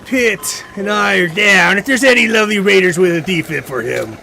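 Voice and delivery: downed streamer pleading loudly for a defib; calling for help. Real, not acted. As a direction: call out for a rescue.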